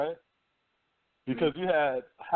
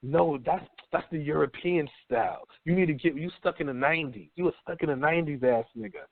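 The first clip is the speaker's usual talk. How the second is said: usual speaking voice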